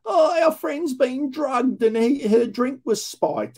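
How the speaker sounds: high, slow mock drunk girl; mimicking drunk women at the station, high and slow; drunk young woman. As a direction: ham up a slurring, high-pitched party girl. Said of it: "Young drunken females like this".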